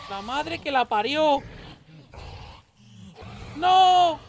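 high panicked screams for help; being chased. Real, not acted. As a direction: scream as the witch catches you. Witch chasing and killing him.

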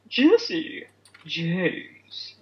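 Team Rocket. Acted. Reciting the Team Rocket motto, theatrical roll-call. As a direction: shout the villain roll-call, drawn out. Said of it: "Is that Team Rocket?".

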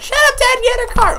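put-on very high squeaky kid voice; bratty son voice. Son telling his dad off, high bratty voice. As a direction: squeal it like a rude child.

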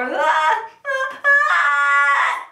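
high, loud crying squawk; baby cry squawk. Shown as a demonstration. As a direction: rattle like a fussing baby. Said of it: "like a baby crying".

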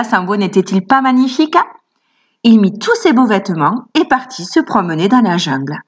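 usual speaking voice

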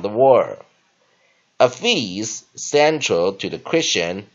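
loud, deliberate model reading in English; measured classroom reading with punched key words; teacher's read-aloud. Shown as a demonstration. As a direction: project each phrase firmly for learners to repeat. Reads the lesson text for practice.

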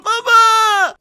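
put-on high, loud little-kid cry; shrieked childlike call; child calling father. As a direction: shout out as a rescued little son. Kidnapped son calls father.

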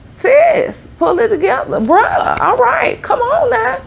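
a bossy bestie telling you to pull it together; high mock scolding, calling you sis and bruh; sassy tear ducts. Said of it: "your tear ducts and stuff be like".

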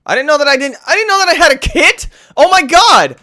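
excited. Real, not acted. Excited discovery.

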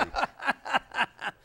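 high, loud burst of laughter; amused, high-pitched laughing fit; real laugh. Real, not acted. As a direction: laugh hard at the joke. Laughing at the tip joke.

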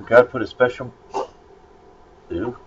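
ordinary delivery

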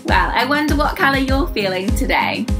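ordinary delivery, nothing marked

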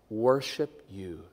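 normal talking voice

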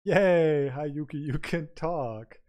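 loud, high, drawn-out cheer; excited greeting. Real, not acted. Streamer cheering a viewer who can talk, real excited greeting. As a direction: burst out in delight, then ease down slightly.

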